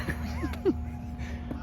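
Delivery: high breathy laugh. Real, not acted. Laughing about being left behind.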